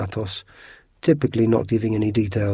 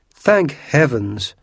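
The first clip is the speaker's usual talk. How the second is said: relieved cry; 5 semitones higher, 6 dB louder, much slower than usual. Retold or imitated. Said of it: "she cried".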